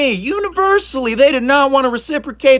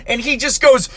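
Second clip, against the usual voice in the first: usual speaking voice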